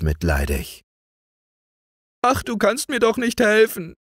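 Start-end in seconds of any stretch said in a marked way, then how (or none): 2.2-4.0 put-on higher girl's voice, plaintive and lightly tearful; lamenting girl. A narrator's reading. Weeping maid laments to the youth, higher and plaintive. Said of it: "jammerte die Kleine".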